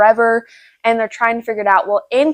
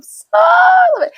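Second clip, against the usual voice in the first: excited squeal; 5 dB louder than usual. Real, not acted. Gushing over a just-finished book.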